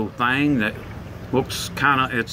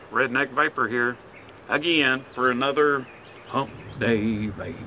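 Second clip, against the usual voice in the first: ordinary delivery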